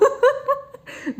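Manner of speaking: high-pitched burst of laughter. Real, not acted. Speaker amused at own bullying story, high laugh. Breaks into laughter mid-anecdote.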